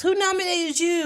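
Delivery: host questioning each mother on stage, high voice; talk show host. Retold or imitated. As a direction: ask it like a peppy TV host. Host interviewing dancing mothers.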